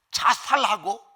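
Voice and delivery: ordinary delivery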